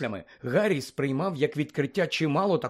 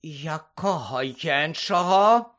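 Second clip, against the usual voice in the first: slow, drawn-out icy question rising at the end; 3 semitones higher, 4 dB louder, much slower than usual. A narrator's reading. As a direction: ask it coldly, stretching each word. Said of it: "спитала мадам Максим крижаним тоном".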